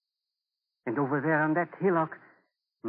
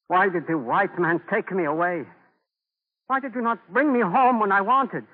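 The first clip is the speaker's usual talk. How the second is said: louder and higher, anguished outcry; anguished cry; 7 semitones higher, 7 dB louder than usual. Acted. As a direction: cry out at the sky in grief. Grief-stricken outburst over bones.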